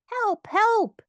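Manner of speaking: high-pitched panicked cry for help; small creature squealing in alarm; Piglet crying out. A narrator's reading. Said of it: "cried Piglet".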